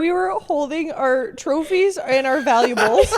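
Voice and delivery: laughing. Real, not acted. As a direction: laugh out the absurd detail. Laughing retelling airport night.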